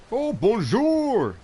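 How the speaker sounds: very high, loud, drawn-out bonjour; cheery French greeting. Acted. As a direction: sing out the greeting with delight. Says his first language is French.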